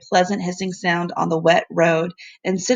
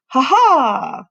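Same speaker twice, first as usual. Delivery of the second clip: hearty laugh; 6 semitones higher, 4 dB louder than usual. Acted. Professor laughs on bike.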